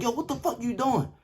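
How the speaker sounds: high-pitched incredulous challenge; retold outrage. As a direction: demand an explanation from the roommate. Confronts roommate after punching him.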